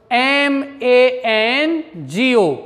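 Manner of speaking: letters spelt out slowly one by one; teacher spelling mango slowly. Shown as a demonstration. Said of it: "spelling out the word".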